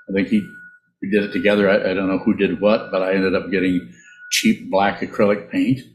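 ordinary talk, nothing marked

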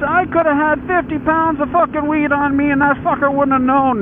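high, excited retold yell; imitating a buddy's high-pitched outburst; incredulous outburst. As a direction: crow it in disbelief, pitch held way up.

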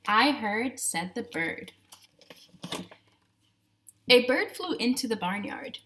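usual speaking voice

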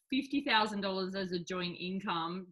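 ordinary talk, nothing marked

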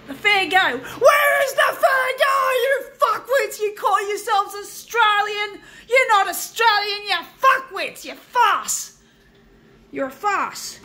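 real high-pitched, full-voiced yelling; furious shouting. Real, not acted. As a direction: scream your outrage at them, stretching the first words. Furious rant at news people.

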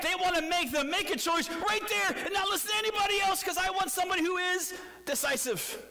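high-pitched, urgent push; mock pressure. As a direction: press for an answer in one insistent high run. Mimics sharks pressing for quick choice.

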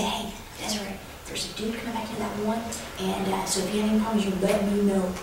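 tip her off quietly so he won't hear; softly voiced warning on the phone to a coworker; hushed phone warning. Discreet phone warning to Desiree.

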